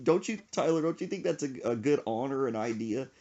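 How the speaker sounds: ordinary talk, nothing marked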